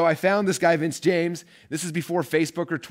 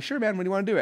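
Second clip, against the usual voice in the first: casual Vince reply; 8 semitones higher, faster than usual. Retold or imitated. Vince replies yes casually.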